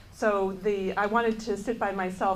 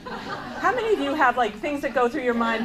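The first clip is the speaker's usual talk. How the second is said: normal talking voice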